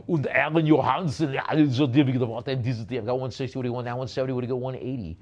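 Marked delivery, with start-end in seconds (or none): 0.1-3.0 loud mock Austrian strongman; Arnold. Auctioneer reading out signatures for bidders, loud strongman impression. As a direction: garble it in a booming comic German accent. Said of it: "the Arnold voice".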